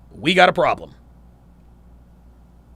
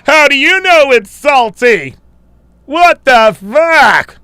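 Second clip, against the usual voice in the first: high, loud, slow mocking yell; mocking the comment with a loud, high, drawn-out shout; 10 semitones higher, 11 dB louder, much slower than usual. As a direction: parody the commenter in a ridiculous squawk.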